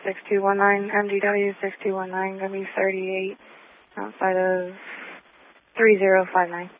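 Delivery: ordinary talk, nothing marked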